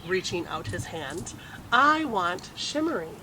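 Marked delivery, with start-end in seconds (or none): 1.7-3.2 put-on little boy wanting. A narrator's reading. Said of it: "Casey reaching for the skirt".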